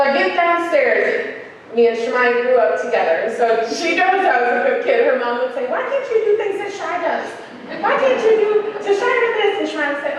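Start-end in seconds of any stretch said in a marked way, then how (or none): 5.7-9.6 nagging mom. Retold or imitated. Friend's mom scolding her daughter, voiced high and nagging. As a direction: whine it like a comparing mother.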